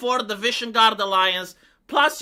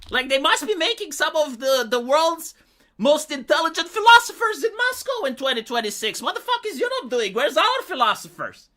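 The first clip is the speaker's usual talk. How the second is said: normal talking voice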